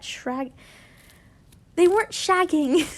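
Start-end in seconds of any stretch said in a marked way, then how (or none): 1.8-2.9 objecting. A narrator's reading. Character objects, correcting the word.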